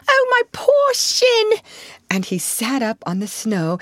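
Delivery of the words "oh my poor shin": slow, injured howl of self-pity; pained cry. A narrator's reading. Mole bemoaning his hurt shin to rat, pained cry. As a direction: wail it high and loud, stretching the words. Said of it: "he cried".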